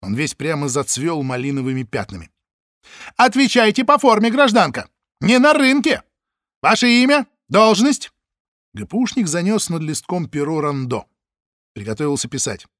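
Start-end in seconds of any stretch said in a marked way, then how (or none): 3.2-8.1 loud, high official barking; angry demand. Acted. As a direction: order her to answer properly. Чекист требует. (злой приказ)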